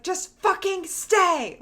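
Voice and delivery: a high, loud, drawn-out yell at the door; exasperated yell. Retold or imitated. Yelling at the elevator door to stay open, exasperated. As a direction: snap at the elevator, slow and loud. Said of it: "and I was like".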